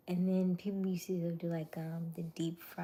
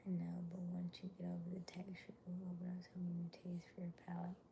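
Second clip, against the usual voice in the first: soft unvoiced whisper; 8 dB quieter, faster than usual. Real, not acted. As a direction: whisper it close to the mic. Whispers throughout stream.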